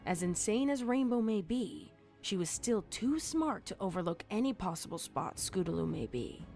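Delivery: usual speaking voice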